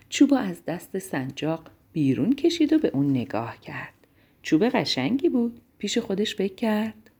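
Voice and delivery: ordinary delivery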